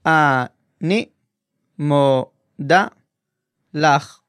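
אני מודה לך, syllables said slowly apart; split into syllables. Shown as a demonstration. Teacher breaking the female phrase into syllables. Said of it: "break it down by syllable".

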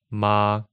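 MA on a flat, constant pitch; level, sustained "ma"; first tone. Shown as a demonstration. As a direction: hold "ma" steady without any movement. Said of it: "just kinda flat".

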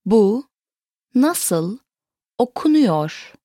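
"Bu", "nasıl", "okunuyor", each set apart; word by word. Shown as a demonstration. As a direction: pause after "Bu" and after "nasıl". Teacher repeats it word by word.

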